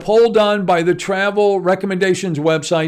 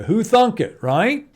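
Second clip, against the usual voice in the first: ordinary talk, nothing marked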